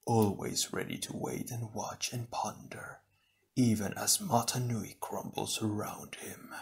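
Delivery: soft, eerie sneering whisper; a hidden evil voice whispering contempt; villain whisper. Acted. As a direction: breathe the insult from the shadows.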